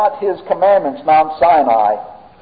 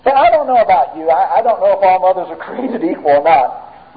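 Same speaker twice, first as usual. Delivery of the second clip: ordinary delivery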